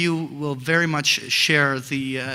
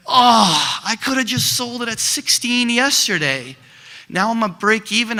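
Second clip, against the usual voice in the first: mock panic; 7 semitones higher, 5 dB louder than usual. Investor panics as stock drops.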